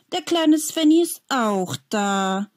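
mock him like a bully. A narrator's reading. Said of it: "spottet Mark sofort". (spöttisch, etwas höher gesprochen)

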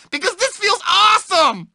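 high, thrilled burst; excited. Acted. Nerd on a sugar rush, bursting with glee. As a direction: blurt it out in glee.

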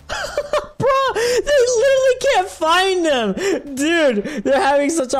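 high, laughing through the words, slow. Real, not acted. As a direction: crack up while you talk. Laughing at other players.

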